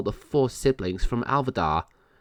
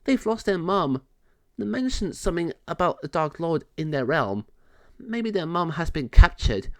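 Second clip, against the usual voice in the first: fairy voice; 6 semitones higher than usual. Acted. Natasha explains to king.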